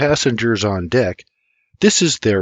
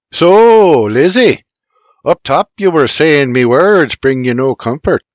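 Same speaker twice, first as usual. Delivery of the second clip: Irish man voice; 4 semitones higher, 7 dB louder than usual. Acted. James Junior character voice.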